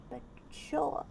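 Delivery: muttered under the breath; grudging whisper; whispered aside. Real, not acted. Sulky aside after being overruled.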